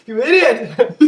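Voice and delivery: high, loud yell through laughter; laughing yell. Real, not acted. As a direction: scream it while cracking up.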